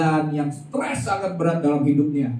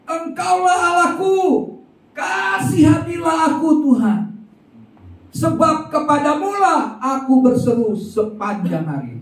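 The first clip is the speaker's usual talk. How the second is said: crying out the psalm. Acted. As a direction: shout the verse as David would. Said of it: "Dia berseru, dia katakan".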